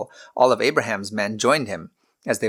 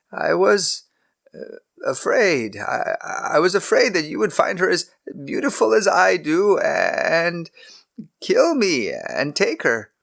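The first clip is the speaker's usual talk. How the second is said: put-on lightly higher, hesitant old patriarch; a caught-out husband explaining; nervous Abraham; 8 semitones higher than usual. A narrator's reading. Abraham voiced by narrator.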